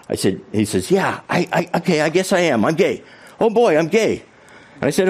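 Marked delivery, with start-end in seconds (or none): 0.9-4.2 nervous coming out. Retold or imitated. Teen coming out hesitantly.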